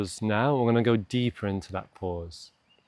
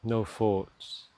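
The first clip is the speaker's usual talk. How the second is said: unhurried meditation voice with long pauses; guided meditation. Acted. Coach slowing a stressed listener's morning to a pause, calm guided meditation. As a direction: guide them gently down into stillness, leaving space to breathe.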